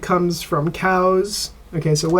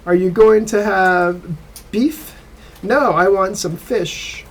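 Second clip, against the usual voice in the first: dialogue read aloud; 3 semitones higher, 3 dB louder than usual. Shown as a demonstration. Teacher reading the textbook dialogue to students, clear and slightly raised. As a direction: read the listening dialogue slightly higher for the class. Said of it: "I'll read it for you".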